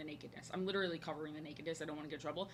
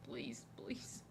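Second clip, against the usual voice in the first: whispered plea; 10 dB quieter than usual. Real, not acted. Begging Disney for a job.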